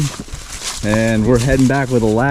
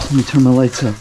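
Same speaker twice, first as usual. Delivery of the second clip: ordinary talk, nothing marked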